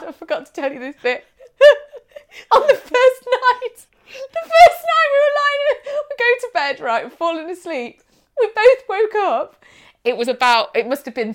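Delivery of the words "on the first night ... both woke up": high, loud laughing telling; can barely get the words out laughing; giggling through the story. Real, not acted. Laughing while telling ghost night.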